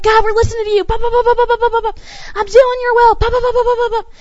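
hammed-up eager pop pop pop; eager believer. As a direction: bounce along like a little engine. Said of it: "We're like".

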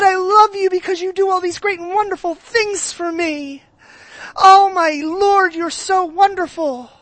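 high, loud, gushing praise voice; mock worship gush. Pastor mocking a gushing worshipper, hammed-up praise. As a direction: ham up an over-the-top worshipper. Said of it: "If I was making fun".

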